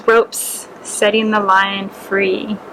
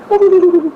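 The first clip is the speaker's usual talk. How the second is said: short, loud, amused laugh; a big cat chuckling at a tiny creature; hearty laugh at the small mouse; lion laugh; 7 semitones higher, 6 dB louder than usual. Acted.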